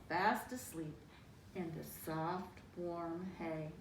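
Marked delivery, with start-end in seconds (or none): none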